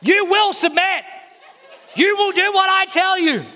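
lampoon a husband lording it over his wife; mock domineering husband. Preacher mocking a husband ordering his wife around, hammed-up bossy shouting. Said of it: "you can go home and go".

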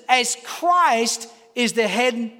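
very slow, stressing Christ; emphatic reading. Shown as a demonstration. Preacher reading scripture, slow and emphatic. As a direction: spell out the verse slowly. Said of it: "Listen to this".

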